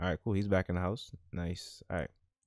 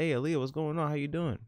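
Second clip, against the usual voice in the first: pitched-up eager greeting; eager dad greeting, voiced higher; high character voice; 8 semitones higher than usual. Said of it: "He's like".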